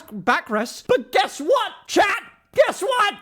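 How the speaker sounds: high-pitched ranting at the audience; shrieky comic outrage without extra volume; exasperated crash-out. Real, not acted. Crash-out rant at chat.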